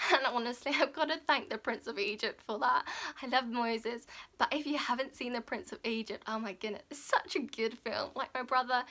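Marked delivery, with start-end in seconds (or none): none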